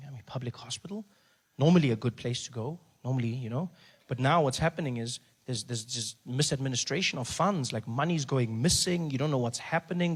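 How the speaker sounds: ordinary talk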